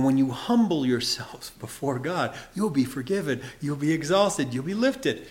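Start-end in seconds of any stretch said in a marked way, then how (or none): none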